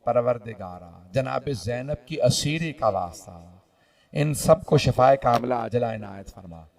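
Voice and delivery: low, soft supplication; subdued, low-voiced dua; closing prayer. Real, not acted. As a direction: bring it right down and pray humbly.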